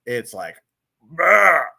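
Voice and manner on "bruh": loud, punchy "Bruh"; explosive. Acted. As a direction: blurt it out. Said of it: "it's so explosive".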